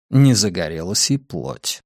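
plain reading voice

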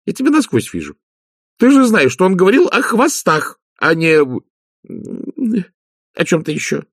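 high-pitched, indignant telling-off; see right through her and say so; scolding. Acted. Magrat accuses Nanny of planning a crude remark, high and scolding.

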